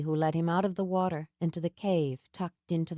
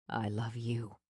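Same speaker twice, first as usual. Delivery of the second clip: lower, softer declaration; low tender Sam; 8 semitones lower, 8 dB quieter than usual. A narrator's reading. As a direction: say it low and close.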